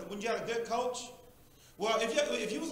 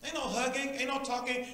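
ordinary talk, nothing marked

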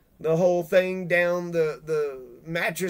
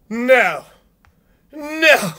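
loud no; 5 semitones higher, 8 dB louder than usual. Real, not acted. Emphatic denial.

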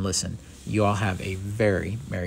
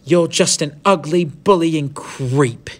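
loud, higher, contemptuous outburst; acted scornful girl standing her ground; defiant insult; 8 semitones higher, 8 dB louder, slower than usual. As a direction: hurl it at the bully, stretching the last words. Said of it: "she threw at him".

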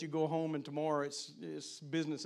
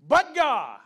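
sudden high ringing shout; exclaimed; 8 semitones higher, 13 dB louder than usual. Acted. Preacher to the men, proclaiming the verse with a loud shout. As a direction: burst out with it like a revelation.

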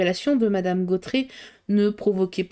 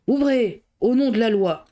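shouting policemen; 2 semitones higher than usual. A narrator's reading. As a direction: call out a command through the door. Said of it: "des voix qui criaient".

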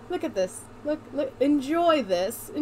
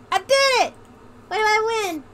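high, loud victory cry; mock triumph; 8 semitones higher, 5 dB louder than usual. Real, not acted. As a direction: celebrate a tiny win at high pitch. Celebrates completing the name entry.

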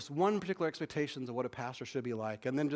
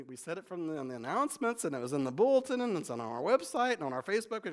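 normal talking voice

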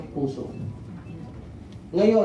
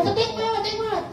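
high mock pleading; faster than usual. Crowd coaxing the feather, high pleading. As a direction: coax it sweetly upward. Telling feather where to land.

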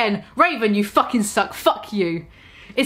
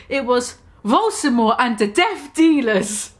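ordinary delivery

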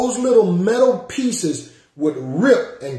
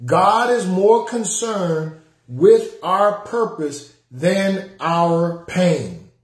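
very slow, weighty delivery; slow emphasis; slower than usual. Shown as a demonstration. Preacher repeating the principle for viewers to type, very slowly. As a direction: drag every word out for the note-takers. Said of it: "Somebody type that in".

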